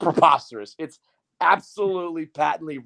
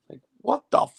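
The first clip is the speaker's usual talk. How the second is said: outraged; 13 semitones higher, 4 dB louder than usual. Real, not acted. Outraged at Wilpon's remark.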